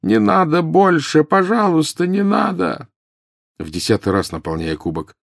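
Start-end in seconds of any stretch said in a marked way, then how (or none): 0.0-2.9 high, drawn-out begging cry; pleading howl of pain; agonised wail. A narrator's reading. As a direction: wail as if burning inside. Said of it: "завопил так, точно его сжигал".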